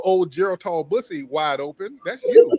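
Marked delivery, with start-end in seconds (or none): 2.0-2.5 That's you yelled high and loud; a sudden high, loud taunt; mocking shout. Real, not acted. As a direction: jab it at him, much higher and louder. Mocking caller during insults.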